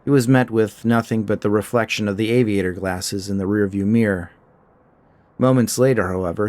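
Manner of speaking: usual speaking voice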